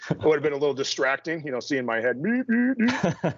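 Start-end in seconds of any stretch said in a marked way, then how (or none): 2.2-3.3 playful high beeps; high-pitched beeping. Retold or imitated. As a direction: mimic little beeps, pitched way up. Imitates head bobbing during squats.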